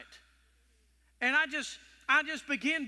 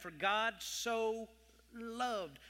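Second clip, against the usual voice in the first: "loved" drawn out slowly and softly, leaning on it; stress on loved; 5 dB quieter, much slower than usual. Shown as a demonstration. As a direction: linger on "For God so loved" very slowly. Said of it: "That word loved right there is very powerful".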